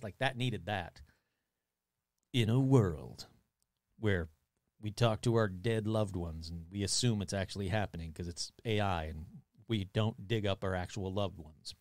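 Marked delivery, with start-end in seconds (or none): 2.3-11.7 low, hushed trailer narrator; lightly voiced sci-fi trailer narrator; movie trailer voice. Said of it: "that bwomp music that happens in, in, like, sci-fi trailers".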